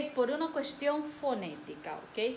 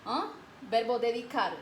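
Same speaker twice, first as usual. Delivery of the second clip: normal talking voice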